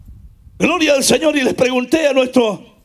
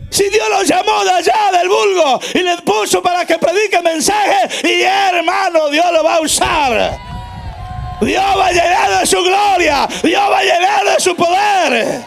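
emphatic proclamation, final words drawn out; full-force preaching; 4 semitones higher than usual. Acted. Preacher defending whom God has called, full-force preaching. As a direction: ring it out as a promise.

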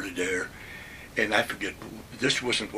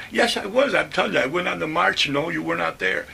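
higher, louder insisting; insistent argument; 5 semitones higher, 4 dB louder than usual. Retold or imitated. Narrator and officer arguing over the march, insistent. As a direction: argue back firmly.

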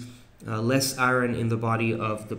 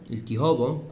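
ordinary talk

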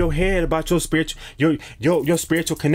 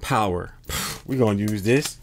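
ordinary talk

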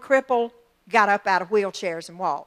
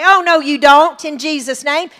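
commanding the storm; 3 semitones higher, 7 dB louder than usual. Pretend. Believer rebuking a storm on TV, a loud rebuke. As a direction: forbid the storm outright. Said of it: "you look at that T V and you say".

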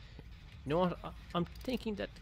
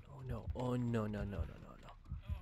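hushed despair; 3 semitones lower, 10 dB quieter than usual. Real, not acted. Caught by killer, despair.

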